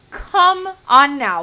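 loud, slow, drawn-out groan of disbelief; exasperated come on now. Real, not acted. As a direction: stretch it out loud, fed up. Exasperated repeat of complaint.

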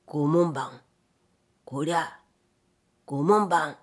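low, hollow, drawn-in eerie call; eerie put-on voice. A narrator's reading. Bored samurai hidden under the window luring the gatekeeper, eerie put-on voice. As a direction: call out in a low, gloomy disguised voice. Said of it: "突然陰にこもった声で呼びました".

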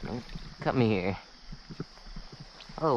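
normal talking voice

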